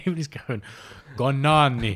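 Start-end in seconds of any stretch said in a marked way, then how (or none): 1.2-2.0 high, loud attempt at an Italian accent; hammed-up bad Italian accent; mock Italian accent. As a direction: imitate an American botching an Italian accent. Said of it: "in Italian accents".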